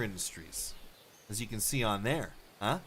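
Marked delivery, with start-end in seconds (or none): none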